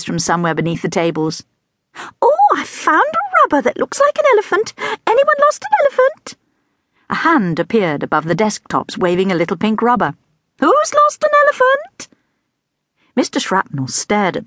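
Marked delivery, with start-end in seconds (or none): 2.2-6.4 much higher, delighted teacher voice; scatty teacher. A narrator's reading. Scatty teacher showing the class a rubber elephant, much higher. 10.6-12.1 much higher, delighted teacher voice; scatty teacher. A narrator's reading. Scatty teacher showing the class a rubber elephant, much higher.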